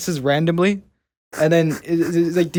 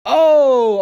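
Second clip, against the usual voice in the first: surprised; 10 semitones higher, 6 dB louder than usual. Real, not acted. Sudden recognition.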